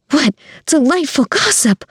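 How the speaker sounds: high-pitched delighted busybody; gossiping woman. A narrator's reading. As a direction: relish the scandal in a high voice. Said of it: "a woman said".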